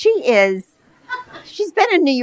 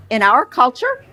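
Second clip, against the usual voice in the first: normal talking voice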